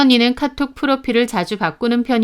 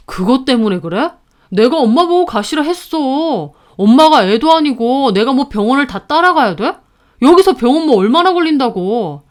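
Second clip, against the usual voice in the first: annoyed retort, rising louder; irritated defensiveness; 4 semitones higher, 5 dB louder than usual. A narrator's reading. Brother excusing himself to his sister, irritated defensiveness. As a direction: brush off the blame, irritated.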